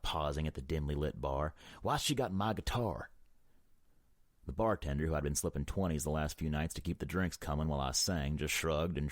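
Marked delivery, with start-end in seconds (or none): 1.8-3.1 higher-pitched indignant demand; gritted out. A narrator's reading. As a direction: demand it with irritation. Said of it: "I gritted out".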